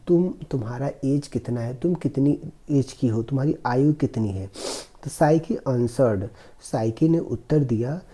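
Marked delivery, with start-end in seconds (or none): none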